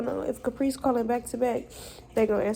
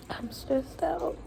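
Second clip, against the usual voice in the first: higher, softer and slow, worn out; weary sigh; 6 semitones higher, 5 dB quieter, slower than usual. Real, not acted. Repeating to viewers, softer and drained. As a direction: let it deflate as you repeat it.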